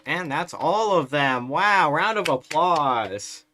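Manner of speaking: loud, slow sarcastic cheer; big fake applause cheer; mock celebration. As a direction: whoop it up like a game show host.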